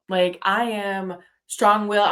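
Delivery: ordinary talk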